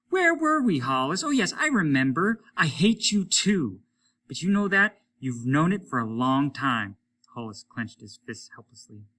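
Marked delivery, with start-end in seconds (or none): 0.1-7.0 high, loud, sneering taunt; gloating spaceman. Acted. Applegate taunting Hollis over the radio, high and gloating. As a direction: savour every cruel word.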